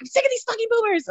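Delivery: high-pitched whiny tantrum. Retold or imitated. Ranting to fiancé about the old sellers, high whiny tantrum. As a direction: throw a bratty fit, way up high. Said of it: "I threw a tantrum".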